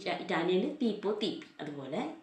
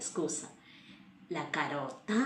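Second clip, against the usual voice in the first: ordinary talk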